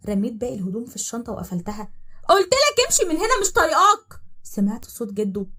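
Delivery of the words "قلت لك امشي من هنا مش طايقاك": صرخة عالية وحادة من ورا الباب; اطرديه بكل صوتك; صراخ غاضب. Acted. (صراخ طرد غاضب عالي)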